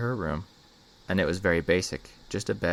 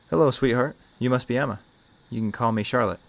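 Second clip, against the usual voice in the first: put-on higher, gentle hello to a little girl; sweet child-directed greeting; 5 semitones higher, 4 dB louder than usual. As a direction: greet a small child, pitched up and friendly. Caseworker kneels to greet little girl.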